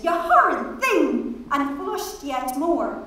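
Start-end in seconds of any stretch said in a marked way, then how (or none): 0.0-1.4 high, scandalised reproach; mock-outraged scolding; protesting. A narrator's reading. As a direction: protest in a raised pitch, falling away. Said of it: "Miss Kennedy protested".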